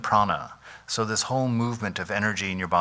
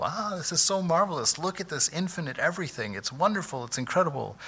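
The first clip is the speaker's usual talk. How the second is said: lightly voiced gushing wonder, pitched up; giddy schoolgirl delight; 7 semitones higher, 4 dB quieter than usual. Monk imitating Vivekananda marvelling at existence, mild giddy delight. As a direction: mimic a teacher thrilled like a schoolgirl. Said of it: "he's just giggling like a little girl".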